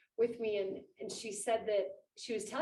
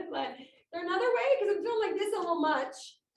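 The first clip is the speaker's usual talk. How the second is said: overwhelmed plea; 9 semitones higher, 6 dB louder than usual. Retold or imitated. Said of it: "When Jesus himself said".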